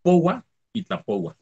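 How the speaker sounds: teacher models both verb forms slowly; slow model words. Shown as a demonstration. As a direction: say Poua then Tlapoua deliberately. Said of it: "Tlapoua es el otro".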